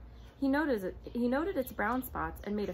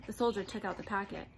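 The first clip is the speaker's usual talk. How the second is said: usual speaking voice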